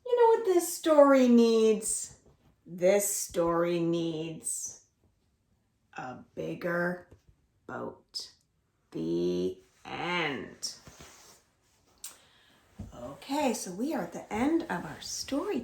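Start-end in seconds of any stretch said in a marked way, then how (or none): 2.7-10.7 slow ending. A narrator's reading. Dramatic slow story ending.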